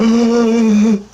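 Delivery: loud, high-pitched gasp; big mimicked intake of breath; shocked gasp. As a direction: gasp sharply, imitating her shock. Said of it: "And she went".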